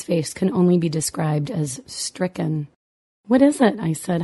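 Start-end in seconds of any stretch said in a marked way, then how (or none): 3.3-3.8 alarmed. A narrator's reading. Mother alarmed by stricken son.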